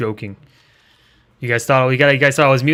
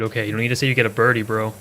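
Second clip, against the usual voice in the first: normal talking voice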